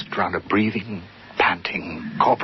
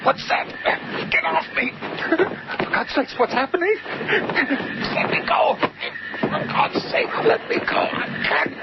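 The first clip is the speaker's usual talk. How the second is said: panicked, strangled cries, much higher; terrified screams. Acted. Harry grappling an invisible thing, screaming in terror. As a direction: fight off an unseen attacker in terror. Attacked in the dark by something.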